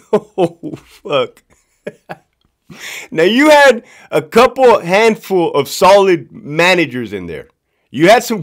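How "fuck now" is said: usual speaking voice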